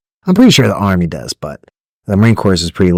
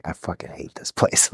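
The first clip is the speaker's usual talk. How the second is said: much softer, whispered grumble; bitter whispered aside; muttered under breath; 4 semitones lower, 11 dB quieter than usual. Retold or imitated. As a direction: say it to yourself so nobody hears. Recruit's inner grumble.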